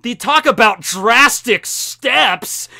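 high, loud, slow punchline; hammed-up pun. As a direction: lean hard on each word of the joke. Acts out Zoro's ladder pun.

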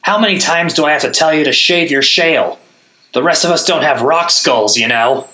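high, loud scolding; exasperated nag. A narrator's reading. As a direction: nag him like a fed-up friend. Gaz scolding the golem.

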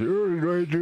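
lightly voiced Stallone, half-numb mouth; Stallone impression. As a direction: mumble it like Stallone with a numb jaw. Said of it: "como Stallone".

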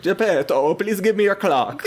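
high-pitched, hammed-up Italian accent; super Italian. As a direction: imitate an eager Italian customer. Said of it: "he's super Italian".

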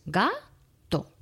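"gato" split slowly into GA... TO, with a clear gap; "gato" broken into two separate, slow beats; counting syllables. Shown as a demonstration. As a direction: say "gato" syllable by syllable, drawing out each beat. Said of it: "vamos a contar el número de sílabas".

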